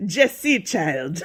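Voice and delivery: amused, badly faked Jamaican accent. Shown as a demonstration. Host jokes about her failed impression, mock Jamaican accent. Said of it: "it's kind of Jamaican".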